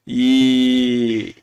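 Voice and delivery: a laugh that turns into a real cry; half laugh, half sob as the memory hits; laugh breaking into a sob. Real, not acted. Father remembering his mother's beatings, laugh-sob.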